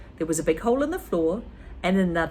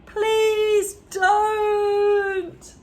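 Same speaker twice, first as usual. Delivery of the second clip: high, loud desperate plea; praying; 13 semitones higher, 6 dB louder than usual. Acted. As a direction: beg it like a prayer, high and loud. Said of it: "he prayed".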